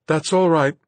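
high, loud, lighter tone. A narrator's reading. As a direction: ease up and let it sound light. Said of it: "a little more lightly".

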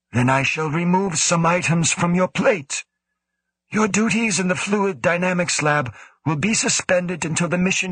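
lightly voiced cool commanding official; stern director. A narrator's reading. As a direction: speak as the stern station director. Woe's voice in read story.

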